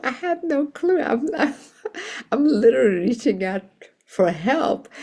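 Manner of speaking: very high, laughing through it; laughing disbelief. Retold or imitated. Reverend telling the kind supervisor she had no clue, laughing. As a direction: giggle out the admission, fast.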